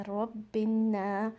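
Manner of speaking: रब्बिन्ना slow, न nasal and long; stretched ghunna. Shown as a demonstration. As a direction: draw out the न of रब्बिन्ना. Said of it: "नून को टू second stretch करना है".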